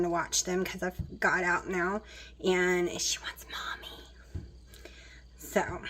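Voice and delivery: hushed whisper. Real, not acted. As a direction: whisper so she won't hear. Kitten crying nearby, mom whispers.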